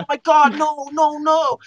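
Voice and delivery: high-pitched retold panic; freaking out. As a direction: wail it like a panicking kid. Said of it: "I'm, like, freaking out".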